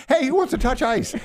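mock tour guide. Plays museum guide with visitors.

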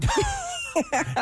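high burst of laughter; short, high-pitched laugh. Real, not acted. Put on the spot to sing on air, laughing.

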